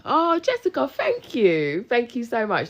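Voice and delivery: high, bright gushing thanks; delighted thanks. Real, not acted. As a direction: squeal out thanks. Thanking a viewer live.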